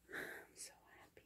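barely audible, happy whisper; overcome whisper. Real, not acted. Opening a longed-for gift, overcome whisper. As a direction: whisper it to yourself, overwhelmed.

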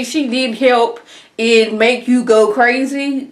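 usual speaking voice

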